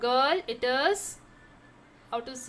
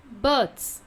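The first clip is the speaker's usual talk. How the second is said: ordinary talk, nothing marked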